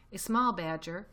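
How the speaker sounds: ordinary delivery, nothing marked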